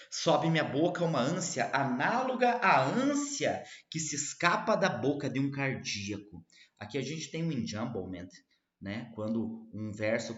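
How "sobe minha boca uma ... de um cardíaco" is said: declamação enfática, com tom elevado; recitação de poema. Acted. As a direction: eleve a voz nos versos e desça no final. Recita os versos. (declamação poética enfática)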